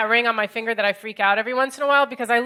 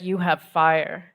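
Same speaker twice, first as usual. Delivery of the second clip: low, slow French-tinged line; a lightly voiced Parisian stranger; French guy; 5 semitones lower, much slower than usual. Parisian stranger asking her for a light, low and slow. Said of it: "he was like".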